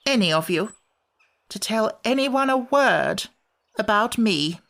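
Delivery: high, grumpy creature voice; a cross little sand-fairy; Samiad voice. Acted. Samiad character voice.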